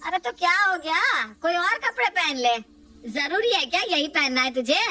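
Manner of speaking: dismissive mother. Acted. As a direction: shrug it off for your son.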